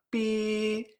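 high, steady "Pi" like a whistle; train whistle. Shown as a demonstration. Teacher compares first tone to train whistling, long high "Pi". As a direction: imitate a train's long level toot on "Pi". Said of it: "de un tren cuando pita".

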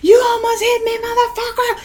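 high, held-back road-rage yell; retold scream. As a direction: act out screaming at a driver. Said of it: "Like, I'm screaming".

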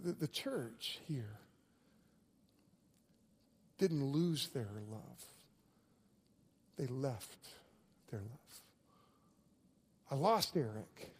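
hushed, deliberate sermon delivery; quiet sermon voice. Acted. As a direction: lean in and say it softly to the church. Quiet intimate sermon stretch.

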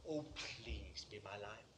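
low, very soft imitated prayer; tentative plea. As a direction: plead quietly, without assurance. Repeated evening prayer.

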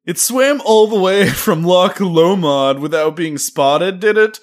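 loud, slow, sarcastic Twitter user; mock a know-it-all online commenter, slowly; hammed-up sarcastic online commenter; snarky Twitter user. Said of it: "That is how Twitter sounds like".

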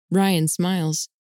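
ordinary delivery

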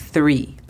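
"Three" with the tongue between the teeth, blowing air; English th in three. Shown as a demonstration. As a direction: blow through the teeth on "Three". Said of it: "colocando nuestra lengua entre los dientes y soplamos".

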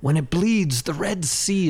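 hammed-up swashbuckler mockery; mock grand insult. Cohost quotes Cyrano's nose insult as a mock grand flourish. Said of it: "thirteen better insults".

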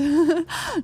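brief chuckle; short laugh. Real, not acted. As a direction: let out a short amused laugh. Amused by his own remark.

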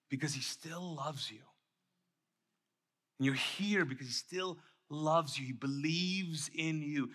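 soft, low and heartfelt; tender reassurance. Real, not acted. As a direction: drop your voice to comfort them. Reassuring listeners of God's love.

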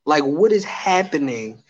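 drawn-out, deliberate bewilderment with long pauses; slow, incredulous. Real, not acted. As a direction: space out every phrase in disbelief. Baffled rant, drawn out for effect.

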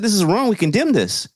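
a hint of a pompous spokesman; mock official statement. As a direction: parrot the leadership's stiff condemnation. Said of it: "They said".

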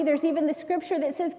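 normal talking voice

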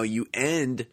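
usual speaking voice

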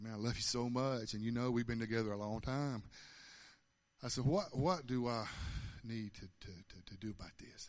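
low, soft, trailing into hesitation; hushed, halting appeal; intimate retold prayer. Retold intimate bedtime prayer to Jesus.

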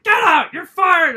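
high, loud firing shout; two explosive high yells; boss yelling. Retold or imitated. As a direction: imitate a boss screaming someone out. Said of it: "I got yelled at".

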